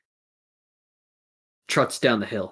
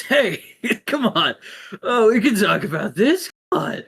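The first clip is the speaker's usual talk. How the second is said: high, louder wheedling plea; nervous pleading; 11 semitones higher, 5 dB louder than usual. Acted. Fighter bargaining with the attacker, nervous pleading. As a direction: try to talk your way out, high-pitched.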